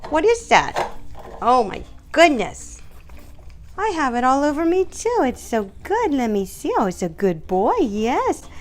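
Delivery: very high sing-song to the dog; high squeaky treat tease for the dog. Real, not acted. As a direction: tempt him with a squeaky treat voice. Praising dog licking treat.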